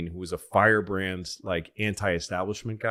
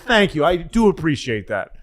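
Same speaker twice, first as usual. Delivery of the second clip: higher, louder weary thanks; mock tired aide; grateful staffer; 6 semitones higher, 6 dB louder than usual. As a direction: thank him, touched. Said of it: "He said".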